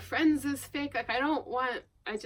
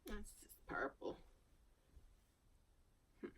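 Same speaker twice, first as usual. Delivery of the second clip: whisper to self; 17 dB quieter than usual. Real, not acted. Muttering to herself over sweaters.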